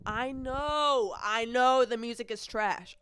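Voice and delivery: exasperated concession. Real, not acted. Conceding music is trash.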